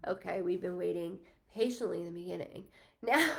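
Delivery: usual speaking voice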